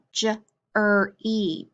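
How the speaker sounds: "j-er-E" slowly, ending in long "ee"; sounded out. Shown as a demonstration. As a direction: break "j-er-E" into three separate sounds. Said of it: "can say E or I".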